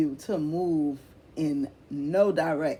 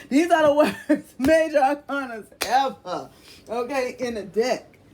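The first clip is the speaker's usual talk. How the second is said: higher, louder, laughing through the words; laughing disbelief; 7 semitones higher, 7 dB louder than usual. Real, not acted. Reader laughs at the grim tarot cards, higher and louder. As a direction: cackle at how bad the cards are.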